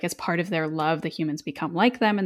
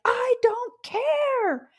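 much higher, very slow and drawn out; defiant cry; much slower than usual. Retold or imitated. Woman refusing the demon, a high drawn-out cry. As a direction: fling it back at him, stretched high. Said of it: "And she's like".

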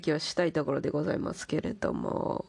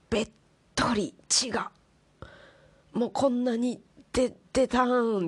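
excited exclaiming; 6 semitones higher than usual. Retold or imitated. Describing blood all over chair.

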